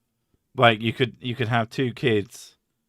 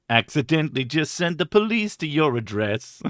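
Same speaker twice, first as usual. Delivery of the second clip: ordinary talk, nothing marked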